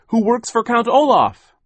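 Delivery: high, loud exclamation; crying out. A narrator's reading. What Sonny's cry meant, exclaimed high and loud. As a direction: call out the revelation. Translating the child's cry of alarm.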